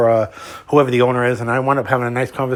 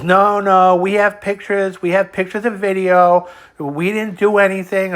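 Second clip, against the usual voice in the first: higher-pitched, drawn-out denial; mock protest; 6 semitones higher, 3 dB louder, slower than usual. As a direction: protest it with raised pitch, stretching the words. Acting out Sergio's protest to customer.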